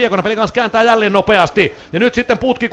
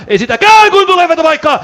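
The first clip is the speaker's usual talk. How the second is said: suddenly much higher and louder, rising excitement; excited rise; 10 semitones higher, 4 dB louder than usual. Real, not acted. Commentator calling the shot chance to listeners, excited higher louder call. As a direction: jump up as the shooting chance opens.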